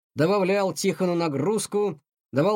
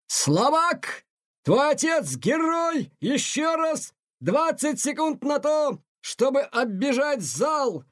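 high, slow, shouting drill instructor; harsh colonel shout; 10 semitones higher, slower than usual. Acted. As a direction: yell every word at him, slow and high. Colonel drilling exhausted cadet.

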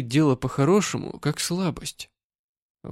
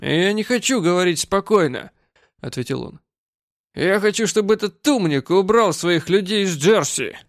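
put-on loud drunk; 5 semitones higher, 3 dB louder than usual. Drunk man refuses calm talk.

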